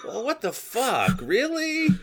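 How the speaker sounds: slow then leaping up high on Really; incredulous at the fishy stuffing; mock disbelief. Guest recoils at fish in stuffing, mock disbelief. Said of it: "and you're like".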